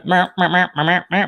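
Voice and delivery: little string of imitation meows, rising; playful meowing noise. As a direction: meow along playfully, pitch rising as you go. Imitated noise while zooming screen.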